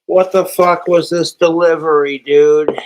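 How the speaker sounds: loud, exasperated shout of disbelief; mock outraged yell. Told his viewers about the trolls' delivery, he yells in mock outrage. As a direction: bellow it like he can't believe the doorbell. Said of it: "I'm like".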